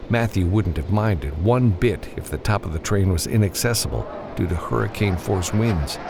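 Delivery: ordinary delivery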